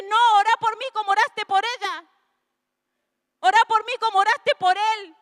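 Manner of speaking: imitando al hijo pequeño, más agudo y fuerte; niño enojado. Retold or imitated. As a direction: reclamo de niño celoso, alto y alzado. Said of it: "se enoja". (el hijo reclama a la mamá, enojado y agudo)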